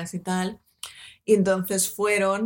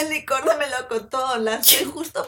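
high, laughing fake phone voice; fake phone call to dad; 6 semitones higher, slower than usual. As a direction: pretend to chat with dad on the phone, giggling. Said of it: "hacer como si estuvieses hablando con tu padre". (voz aguda y risueña de llamada fingida al padre)

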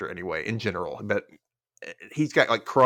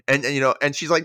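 ordinary delivery

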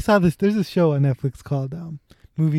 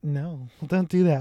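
ordinary talk, nothing marked

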